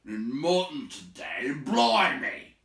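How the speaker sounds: rough troll voice, loud and slow on mutton; a sulky troll complaining about supper; grumbling troll. Acted. A troll moaning at his mates about mutton again.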